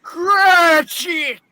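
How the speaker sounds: high loud call. Acted. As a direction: summon him sharply. Scrooge calls clerk.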